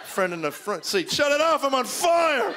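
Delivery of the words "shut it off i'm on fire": high, hammed-up cry of alarm; mock panic, rising louder on the last word; panicked yell. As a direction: yell it like your legs are burning. Acted passenger burned by heater.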